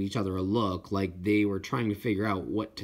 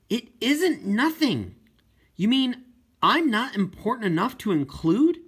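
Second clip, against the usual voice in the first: raised, louder, indignant and wounded; hurt protest; 11 semitones higher, 4 dB louder than usual. Acted. Deaf girl to her dad at dinner, hurt and indignant. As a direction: push back, stung at being left out.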